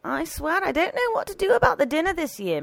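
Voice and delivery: high, fretting complaint; exasperated housekeeper. A narrator's reading. Housekeeper despairing over the birthday dinner, exasperated.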